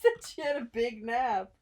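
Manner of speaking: laughing hard while talking; can barely get the words out from laughing. Real, not acted. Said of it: "That's literally what you just said".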